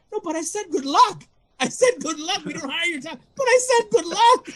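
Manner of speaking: defending himself in his head, high whiny plea; mock pleading. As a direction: plead the point in a squeaky voice. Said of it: "in my head, I'm like".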